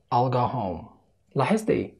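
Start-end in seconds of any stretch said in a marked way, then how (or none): 0.1-0.8 "I'll go home" quick and contracted; hurried speed. Shown as a demonstration. Teacher shows learners the hurried speed, contracted. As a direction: squeeze I will into "I'll", keeping the L. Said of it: "وبعد كده المستعجلة".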